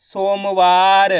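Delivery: सोमवार stretched very slowly, each syllable held; slow demo of सोमवार; slow blended reading. Shown as a demonstration. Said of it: "तो मिलके बन जाएगा".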